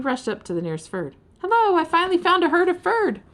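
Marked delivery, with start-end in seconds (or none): 1.4-3.2 high, loud eager hello; excited greeting. Acted. Ferd eagerly greeting the nearest Ferd, high and loud. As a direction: rush up bursting with joy.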